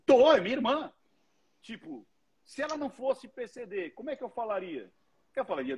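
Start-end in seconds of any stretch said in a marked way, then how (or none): 0.1-0.9 higher, louder, matter-of-fact retort; blunt sister. Retold or imitated. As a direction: snap back casually, pitched up and loud. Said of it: "E é tipo".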